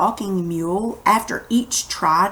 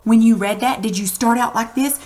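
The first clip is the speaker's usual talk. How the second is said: usual speaking voice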